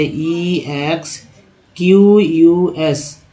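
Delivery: letters dragged out slowly; slow spelling out. Shown as a demonstration. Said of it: "जब ई x से word meaning start हो". (धीमी गति से स्पेलिंग)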